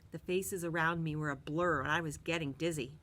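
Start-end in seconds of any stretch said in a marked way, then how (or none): none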